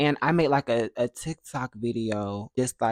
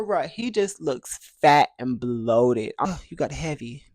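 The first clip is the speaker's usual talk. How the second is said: normal talking voice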